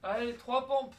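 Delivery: high, loud coach call; shouted count. Real, not acted. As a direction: bark the rep count out. Coach calling push-up count.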